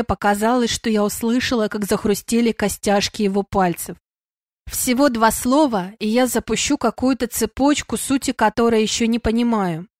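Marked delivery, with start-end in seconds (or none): none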